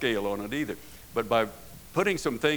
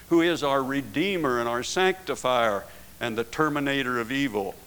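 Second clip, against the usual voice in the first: preaching at full force; 4 semitones higher, 5 dB louder than usual. Acted.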